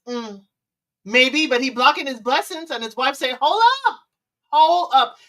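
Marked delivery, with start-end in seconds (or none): 3.4-5.2 higher, louder halt; mock stop command. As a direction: stop him like a crossing guard. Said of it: "She put up that crossing guard stop".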